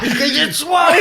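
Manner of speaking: high laughing mock yell; mock teacher shout. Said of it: "mimicking the teacher shout".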